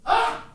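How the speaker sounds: sudden high "ah!" to startle a dog; mock scream. Mom testing shelter dogs, short mock scream. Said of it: "Just screaming at dogs".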